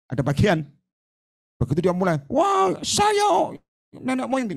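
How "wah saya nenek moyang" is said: much higher, strange boastful spirit; ancestor spirit voice. Retold or imitated. Spirit boasting it is the ancestor, high and strange. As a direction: announce yourself as the ancestor spirit. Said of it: "saya bilang".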